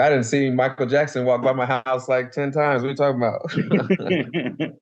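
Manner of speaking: imitating a friend's laughing disbelief; laughing mock disbelief. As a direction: scoff at the idea while cracking up. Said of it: "Luke's like".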